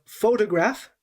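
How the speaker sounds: "photograph" with the stress on PHO; teacher's clear demo of PHO-tograph; stress on first syllable. Shown as a demonstration. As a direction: hit the start of "photograph". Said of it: "we're stressing different parts of this word".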